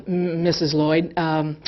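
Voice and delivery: an embarrassed dentist clearing his throat; sheepish dentist. Retold or imitated. Dentist voiced, awkward confession.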